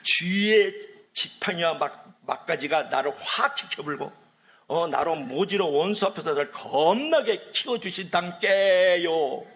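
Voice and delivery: slow, drawn-out Jeolla dialect; Jeolla accent. Shown as a demonstration. Preacher giving the Jeolla version of the psalm, Jeolla accent. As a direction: ham up the psalm in Jeolla dialect, stretching the endings. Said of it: "전라도 버전을 한번 할게요".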